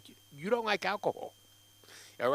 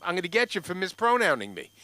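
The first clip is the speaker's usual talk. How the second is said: usual speaking voice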